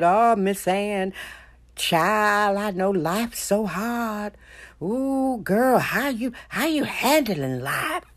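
slow, syrupy mock pity, rising on "Ooh"; condescending sympathetic voice, hammed-up; mocking fake sympathy. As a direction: pat them on the head with fake concern. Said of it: "No, I don't speak like that".

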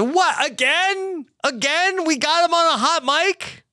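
very high, incredulous questions; retold disbelief. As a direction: squeal it in theatrical astonishment. Retells own disbelief at repeated trick.

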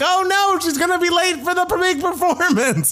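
very high, frantic squeal; Billy Eichner impression. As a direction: shriek it like a panicking elf. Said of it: "And so Billy's like".

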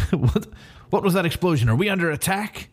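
high-pitched startled questioning; mock alarm. Martian Manhunter asks about the explosion, mock alarmed. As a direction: call down in hammed-up alarm.

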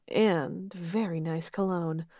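usual speaking voice

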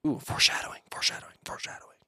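hushed, quickening whisper; soft, voiceless teasing aside; playful whisper. Pretend. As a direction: whisper it like a secret hint. Spooky aside hinting at what comes.

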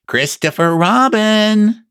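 high, drawn-out call from above. Acted. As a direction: sing out the name slowly to someone far below. Pooh calls down again.

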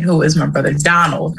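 ordinary delivery

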